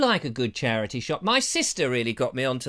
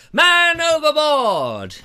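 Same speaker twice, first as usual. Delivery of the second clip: slow, higher, louder pirate cry; man overboard shout; 10 semitones higher, 7 dB louder, much slower than usual. Pretend. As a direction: drag out the alarm call. First comic cry.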